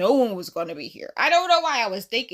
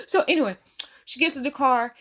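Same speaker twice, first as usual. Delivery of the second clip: normal talking voice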